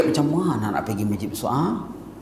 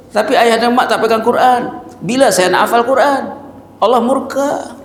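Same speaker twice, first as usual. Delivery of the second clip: put-on high, pleading child reproaching his parents; child's voice; 9 semitones higher than usual. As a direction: speak like a boy begging his parents to read Quran. Preacher voices child scolding parents.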